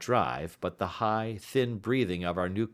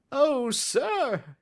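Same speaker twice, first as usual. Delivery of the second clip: cry; 4 dB louder than usual. A narrator's reading. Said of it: "he cried".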